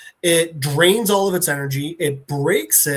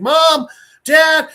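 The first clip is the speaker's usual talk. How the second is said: high, loud retold shouts; acted yell for parents, pounding to get in; angry calling; 9 semitones higher, 6 dB louder than usual. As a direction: imitate an upset kid calling out. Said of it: "he's also getting angry".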